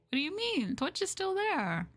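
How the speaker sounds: high, incredulous question; surprised. Real, not acted. Streamer answering chat's Twitch worry, high and incredulous. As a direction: act baffled, pitch jumping up.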